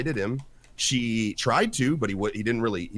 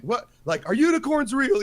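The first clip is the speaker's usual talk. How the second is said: high-pitched silly question; mock yell; 11 semitones higher, 3 dB louder than usual. Townsman yelling ridiculous question at God, high mock yell. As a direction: imitate a dim audience member calling out. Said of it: "one guy yelled the most ridiculous".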